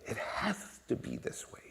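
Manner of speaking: slow, whispery emphasis; hushed insistence. Real, not acted. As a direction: press it home slowly under the breath. Preacher drops to hushed emphasis.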